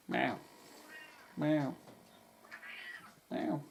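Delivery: light mock meow, repeated; playful imitated cat meows; imitating a meow. Meowing back at the cat.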